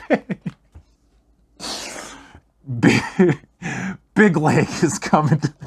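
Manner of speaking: dwarf bellowing his own name; delirious shout. Acted. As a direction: shout it like a fevered war cry. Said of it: "and starts to shout".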